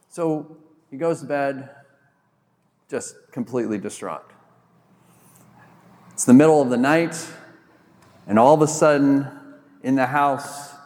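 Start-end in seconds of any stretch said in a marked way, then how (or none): none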